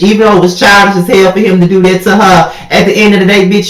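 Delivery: ordinary talk, nothing marked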